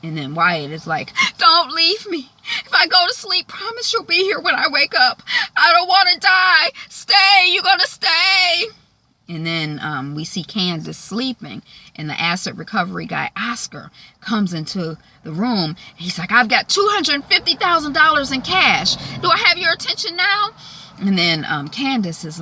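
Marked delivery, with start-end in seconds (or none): 1.4-8.6 high, loud, panicked begging; desperate pleading. Retold or imitated. Wyatt begs Jeff not to leave, desperate pleading. 16.3-20.5 high, raised announcement; emphatic bragging. Retold or imitated. Oscar tempts Candace with cash, emphatic bragging. Acted boastful offer.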